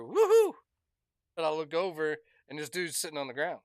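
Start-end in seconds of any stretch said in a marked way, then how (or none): 0.0-0.5 much higher, louder yelp; falling whoop. Retold or imitated. Technician falling off the ladder with a loud whoop. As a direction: whoop as you fall. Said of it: "'cause I hear".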